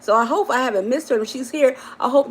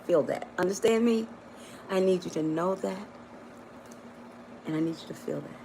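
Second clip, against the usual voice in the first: fading intimate murmur; 3 semitones lower, 11 dB quieter than usual. Real, not acted.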